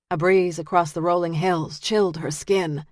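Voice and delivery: plain reading voice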